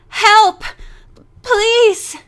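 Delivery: high, loud panicked plea; gasping desperate call for help; desperate cry for help. A narrator's reading. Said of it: "she sputtered desperately".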